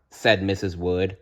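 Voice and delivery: usual speaking voice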